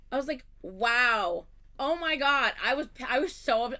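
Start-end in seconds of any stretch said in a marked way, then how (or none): 0.6-2.5 loud disbelieving "wow"; indignant exclamation. Retold or imitated. Reacting to her friend's claim, loud indignant disbelief.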